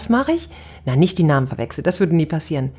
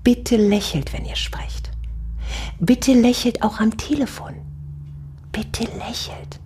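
gentle plea to smile; 3 semitones higher, slower than usual. Retold or imitated. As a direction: coax them to smile, half whispered. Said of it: "ich sag meinen Töchtern immer".

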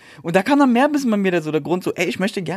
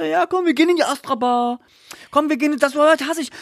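higher, whiny imitation of the guy; mocking the pushy guy; 7 semitones higher than usual. Retelling how the guy kept pushing for the Astra bar, higher mock voice. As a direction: parrot his nagging in a raised voice. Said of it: "die ganze Zeit so".

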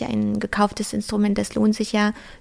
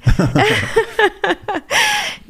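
high falling laughter; real laugh winding down; 12 semitones higher, 5 dB louder than usual. Real, not acted. Laughs at Berghain suggestion.

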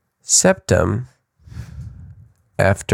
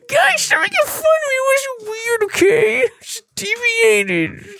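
much higher, whining and laughing; whiny high mock voice; slower than usual. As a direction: whine like a hurt kid.

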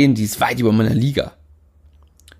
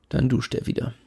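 ordinary delivery, nothing marked

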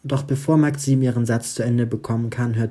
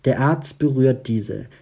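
ordinary delivery, nothing marked

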